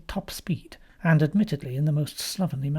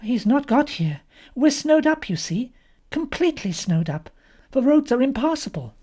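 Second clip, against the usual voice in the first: higher-pitched worried objection; Molly protesting by phone; 9 semitones higher, 3 dB louder than usual. A narrator's reading. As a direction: explain the problem urgently to the caller.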